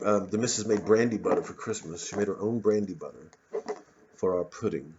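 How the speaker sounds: normal talking voice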